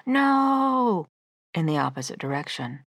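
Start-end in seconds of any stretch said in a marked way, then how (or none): 0.1-1.1 high, drawn-out defiant refusal; acted toddler tantrum cry, high and long; toddler's mock scream. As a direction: imitate a toddler yelling in defiance. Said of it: "and runs screaming".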